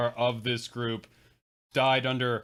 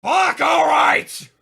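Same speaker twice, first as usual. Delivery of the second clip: shout; 12 dB louder, much slower than usual. Acted. Bracing to play hyena.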